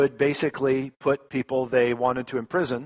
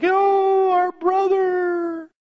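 slow, high, wailing grief; the sisters sob over their brother; mock tearful cry; 6 dB louder, much slower than usual. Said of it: "sisters are freaking out and, uh, crying".

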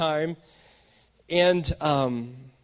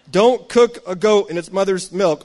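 laughing read; 3 semitones higher, 8 dB louder than usual. Pretend. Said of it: "Oh, this, okay".

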